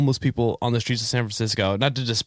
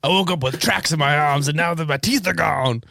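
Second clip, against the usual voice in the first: toothless; 6 semitones higher than usual. Retold or imitated. Voicing Mel, toothless.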